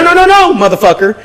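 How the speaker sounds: high loud no; retold shout. Stopping the thief at the shelf, shouting. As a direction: stop him cold with a yell.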